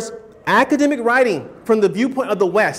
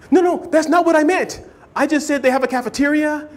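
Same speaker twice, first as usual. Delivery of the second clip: higher, rising panicky protest; mock flustered denial, much higher; 6 semitones higher than usual. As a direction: backpedal in a hurry, pitch climbing. Acted denial to a friend.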